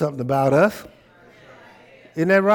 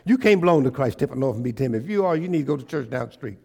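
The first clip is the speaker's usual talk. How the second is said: normal talking voice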